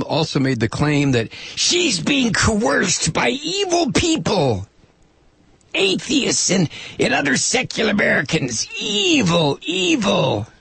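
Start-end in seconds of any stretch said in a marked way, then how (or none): 1.5-8.7 lightly voiced sneering politician; slow mocking paraphrase. Mocking Palumbo's claim. 8.8-10.5 high hammed-up "Evil, evil"; mock ominous. Mocking the word evil.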